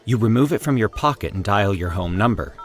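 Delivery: plain reading voice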